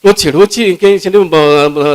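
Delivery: usual speaking voice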